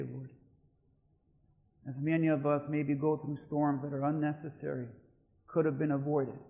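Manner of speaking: soft, low, even praying voice; prayer. Real, not acted. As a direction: pray quietly on behalf of the church. Leads the congregation in prayer.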